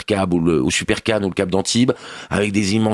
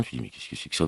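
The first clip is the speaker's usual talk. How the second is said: whispered, much softer disbelief; baffled whisper; 6 semitones lower, 13 dB quieter, faster than usual. Retold or imitated. Viewer baffled by the dubbing, whispering in disbelief. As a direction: hush it like a stunned viewer.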